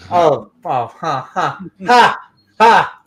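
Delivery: loud staccato ha ha laugh; pulsed ha sounds. Real, not acted. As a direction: spell out each ha loudly. Joke about Missus Zach.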